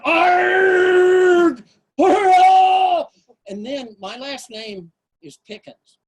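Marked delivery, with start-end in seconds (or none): 0.1-3.1 high, loud cartoon yell of frustration; Charlie Brown scream. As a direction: let out a furious cartoon howl. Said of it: "it was like a Charlie Brown".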